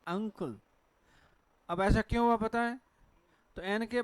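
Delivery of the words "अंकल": अंकुल with ng into k; correct nasal pronunciation. Shown as a demonstration. Teacher giving class the right form, nasal ng. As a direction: say अंकुल as angkul. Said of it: "अनकल नहीं है".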